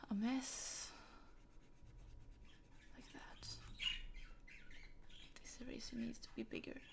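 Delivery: soft unvoiced whisper while she draws; whispering to herself. Real, not acted. Artist quietly whispering to herself over the drawing. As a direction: breathe the words out to yourself, absorbed in work.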